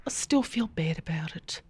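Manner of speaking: breathy whispered echo. Retold or imitated. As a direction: whisper it back softly. Echoing caller in whisper.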